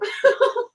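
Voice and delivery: real high laugh. Real, not acted. Bursts out laughing at story.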